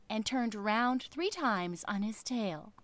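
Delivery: plain reading voice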